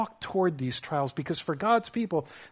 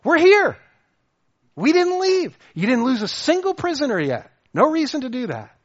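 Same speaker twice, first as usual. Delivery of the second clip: raised, high call of reassurance; 9 semitones higher, 8 dB louder than usual. Retold or imitated. Paul calling out to the despairing jailer, raised and urgent. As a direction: call it out high and loud so he stops. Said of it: "And Paul says".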